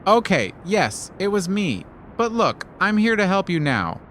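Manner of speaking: a sheepish confession, voiced high; high sheepish spider. A narrator's reading. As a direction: spider caught out, owning it.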